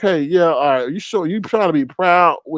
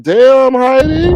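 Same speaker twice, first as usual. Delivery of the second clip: loud, higher damn Heidi; mock shock; 8 semitones higher, 7 dB louder, slower than usual. Streamer retelling a guest's swearing, loud mock shock. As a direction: exclaim her name in fake shock. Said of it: "I was like".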